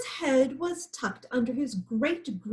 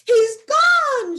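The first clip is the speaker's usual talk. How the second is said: much higher, louder cheer; joyful shout; 13 semitones higher, 11 dB louder than usual. A narrator's reading. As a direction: cheer that the lion fled. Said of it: "shouted the peccaries".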